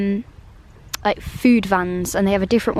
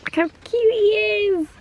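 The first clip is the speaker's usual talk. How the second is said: cooing over duck; 11 semitones higher, faster than usual. Real, not acted.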